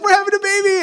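very high and loud joyful repeat; excited echo. Retold or imitated. Wife echoing the pregnancy news, high joyful shout. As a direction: squeal it back at him. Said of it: "She's like".

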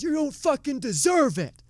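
high-pitched mock yell, retelling the outburst; imitating an angry yell. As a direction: throw it back like a furious comic storming off. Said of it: "he yells at the crowd".

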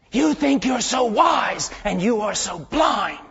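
stern rebuke. Retold or imitated. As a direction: drive the rebuke home slowly. Preacher rebukes the boy.

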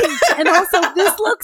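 high, loud, thrilled gasp of wonder; gushing amazement. Retold or imitated. Heroine gushing over the escape room in thrilled amazement. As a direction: squeal it like she's seeing a dream come true.

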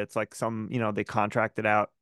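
ordinary delivery

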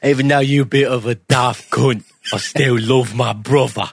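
hammed-up Cockney accent; mock British accent. Co-host jokingly declares brotherly love, in mock Cockney. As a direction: echo him in a put-on London accent. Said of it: "kind of British accent".

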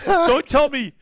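high-pitched imitation of an offended man; mock indignant protest. Acts out the retort's next line.

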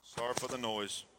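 talking through a face mask; muffled, covered mouth. Real, not acted. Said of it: "Can y'all hear me through this mask?".

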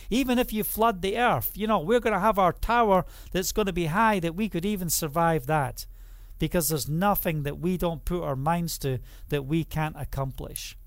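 normal talking voice

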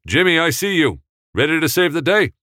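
loud, high friendly call across the set; calling to the star. Acted. Director calling out to Jimmy across the set, loud and high. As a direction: cheer the star on from afar. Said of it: "He looked over at Jimmy".

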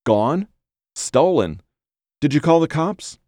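stunned, anxious disbelief on the phone; terrified like a child. A narrator's reading. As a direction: ask it in rising alarm. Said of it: "Jack's voice sounded strange, like a terrified child".

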